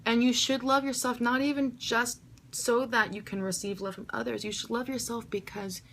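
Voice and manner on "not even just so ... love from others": usual speaking voice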